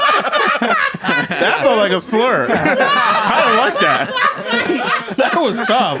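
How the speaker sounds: high squealing laughter through the words; real fit of giggles, barely getting words out; laughing hard. Real, not acted. Cohost reacting to a British pronunciation, helpless laughter. Cracking up at the slur.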